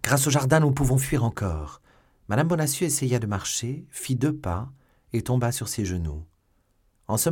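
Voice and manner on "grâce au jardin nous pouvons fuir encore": louder, higher urging; urgent pleading. A narrator's reading. Milady urging escape through the garden, urgent cry. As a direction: press her to flee.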